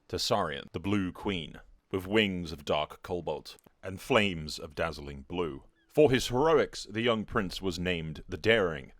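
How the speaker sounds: measured, solemn storyteller delivery; grand fantasy prologue narrator; epic story narration. A narrator's reading. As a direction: recount it like a chronicle of war.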